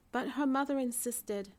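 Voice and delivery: ordinary delivery, nothing marked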